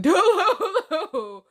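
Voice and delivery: high, loud, drawn-out "Delulu"; hammed-up singsong mockery; playful mocking. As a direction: stretch out Delulu teasingly.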